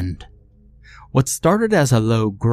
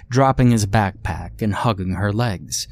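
ordinary delivery

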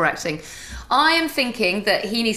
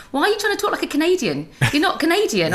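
mock scolding, pitched higher; exasperated husband; 6 semitones higher than usual. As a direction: imitate a husband's incredulous telling-off. Imitates husband scolding her accent.

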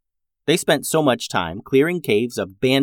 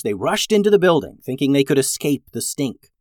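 ordinary delivery, nothing marked

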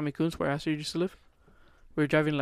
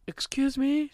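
retold call out; 10 semitones higher, much slower than usual. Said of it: "she yelled out the window".

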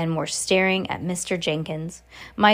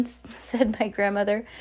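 plain reading voice

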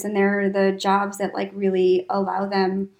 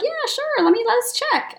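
high, quick, happy yes; cheerful agreement. Retold or imitated. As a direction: toss it off brightly. Manager quote, agreeing brightly.

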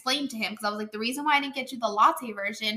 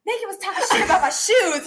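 higher, louder laughing tattle; imitating a tattling kid; 9 semitones higher, 6 dB louder than usual. Friend mimics the boy complaining about his shoes, laughing high voice. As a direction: mimic a boy telling on someone. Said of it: "he's like".

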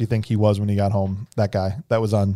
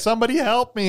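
high, put-on distress call at talking volume; mock scream for help; 10 semitones higher than usual. Beverly calling out after untying herself, mock scream for help. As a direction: imitate a needless plea, pitched well up. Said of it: "Why are you screaming for help".

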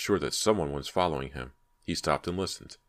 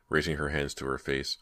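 ordinary delivery, nothing marked

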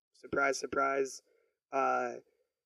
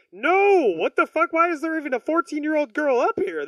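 higher, louder shocked outburst; outraged shout; 11 semitones higher, 7 dB louder than usual. Retold or imitated. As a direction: yell it in appalled disbelief. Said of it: "And he's like".

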